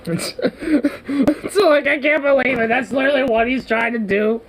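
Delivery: high, squeaky laughing talk; laughing in disbelief. Real, not acted. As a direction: barely get the words out through laughter.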